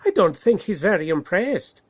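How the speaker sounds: a fox teasing, quickly; sly fox. Acted. Fox remarking on the eagle, quick and wry. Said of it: "said the fox".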